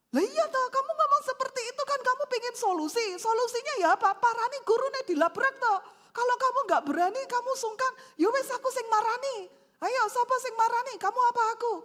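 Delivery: high, loud hot-headed husband; worked-up, emotional. Acted. Husband pushing wife to confront the teacher, worked-up. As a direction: argue heatedly, pressing on without a break. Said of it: "ojo emosi".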